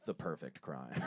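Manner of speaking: low, soft, self-satisfied murmur; smug hush. Retold or imitated. Boy praising his own backyard burial, soft and smug. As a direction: savor it under your breath. Kid's triumphant whispered verdict.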